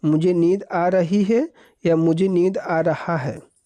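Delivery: usual speaking voice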